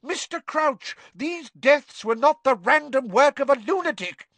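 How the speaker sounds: high, loud and fierce; furious McGonagall. A narrator's reading. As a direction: hurl the names at him.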